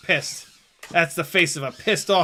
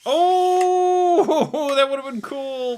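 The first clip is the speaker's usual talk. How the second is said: excited laugh; 11 semitones higher, 5 dB louder, much slower than usual. Real, not acted. Reacting to a near trick shot.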